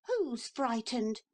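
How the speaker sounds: put-on high, drawn-out boyish retort; boy's slow defiant repeat. A narrator's reading. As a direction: repeat it stubbornly. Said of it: "said Neville again".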